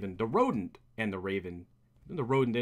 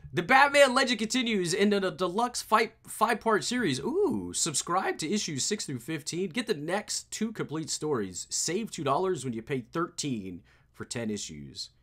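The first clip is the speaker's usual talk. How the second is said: higher, announcer-style ad read; 7 semitones higher than usual. Acted. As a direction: sell the subscription like a promo. Reads comic ad.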